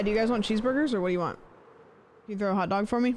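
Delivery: ordinary delivery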